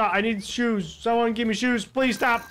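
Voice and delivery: usual speaking voice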